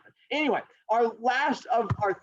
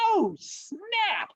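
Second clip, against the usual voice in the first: high, startled realisation; hammed-up panicked surprise; mock alarm; 13 semitones higher than usual. Rabbit seeing turtle near finish, mock alarm.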